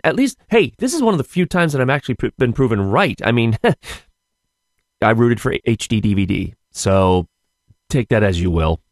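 ordinary delivery

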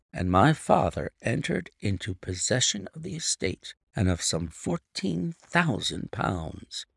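ordinary delivery

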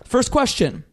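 bright quiz host announcing; game show host voice. Acted. Hosting the quiz.

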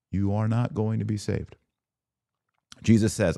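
usual speaking voice

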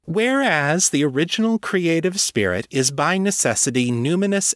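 high-pitched, declamatory document reading; indignant recital of an official declaration; reading a proclamation aloud. A narrator's reading. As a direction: read out a formal decree, pitched well up. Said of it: "she read from it".

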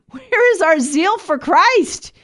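high, drawn-out rallying cry; impassioned cry. Real, not acted. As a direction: cry it out slowly, pitch climbing high. Impassioned challenge to listeners.

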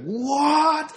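the happy guy finally snapping; sudden snap. Retold or imitated. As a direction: jump way up in pitch on the single word. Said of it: "eventually the guy is like".

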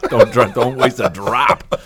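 loud, slow line through laughter; laughing joke. Real, not acted. Host teasing guest about spilled coffee, laughing. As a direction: laugh while you tease them not to waste it.

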